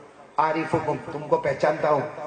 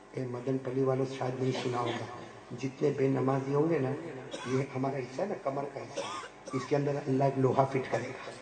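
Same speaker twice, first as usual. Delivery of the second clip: hushed warning; 8 dB quieter than usual. Real, not acted. Preacher warning the audience, hushed. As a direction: drop the voice to a confiding hush. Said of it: "hushed warning to audience".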